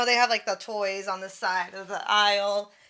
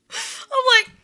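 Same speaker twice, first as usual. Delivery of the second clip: squeaky laugh through the words; laughing high; 4 dB louder than usual. Real, not acted. Recalling the creepy customer, laughing high. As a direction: crack up as you say it.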